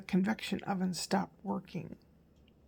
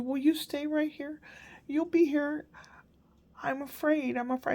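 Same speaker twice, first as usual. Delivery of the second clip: put-on high little-boy voice begging grandma to stay; lightly voiced frightened grandson; pleading scared child; 7 semitones higher than usual. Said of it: "He goes".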